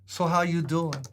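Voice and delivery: Bronx accent, a touch of attitude. Shown as a demonstration. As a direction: greet like a New Yorker from the Bronx with a little attitude. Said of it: "You have to have a slight attitude".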